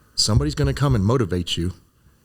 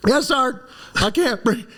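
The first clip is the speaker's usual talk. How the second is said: much higher, pinched and desperate; strained recruit; 13 semitones higher, slower than usual. Retold or imitated. Recruit pinned under wire answers sergeant, strained and high. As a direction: squeeze it out like there's no air. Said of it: "And I said".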